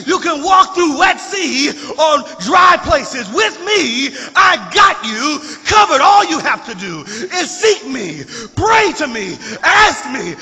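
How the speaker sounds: loud, high, rhythmic sermon cadence; full-force preaching. Acted. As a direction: build the congregation up with every line. Preaching climax with music.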